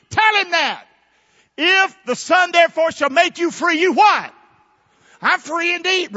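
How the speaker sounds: high, loud, shouted sermon; preaching at full force. Acted. Preacher shouting to the congregation, full force. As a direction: shout the freedom like a jubilant preacher.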